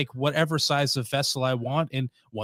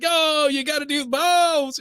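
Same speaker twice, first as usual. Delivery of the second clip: high, drawn-out mock holler; 14 semitones higher, 3 dB louder, slower than usual. Imitating Italian ladies watching people mess up food, hollering. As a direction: holler it out slowly, imitating indignant food critics. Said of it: "and hollering like".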